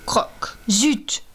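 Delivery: whispered snap. Acted. Shark misses its bite.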